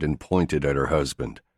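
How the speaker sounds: ordinary delivery, nothing marked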